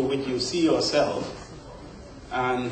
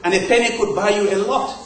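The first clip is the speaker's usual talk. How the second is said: high-pitched, quick imitation of a complaining elder; nostalgic elder; 8 semitones higher than usual. Poet imitates older Africans missing colonial days, high nostalgic grumble. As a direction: lament the lost old days in a raised voice. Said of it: "they always seem to have this nostalgia".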